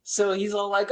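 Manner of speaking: ordinary talk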